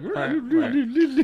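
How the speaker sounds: high, laughing meows for a dog in a bear suit; mock cat meow. Imitating the bear-suited dog on the treadmill, high laughing meows. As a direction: squeak out meows through a laugh. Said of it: "And so he's just like".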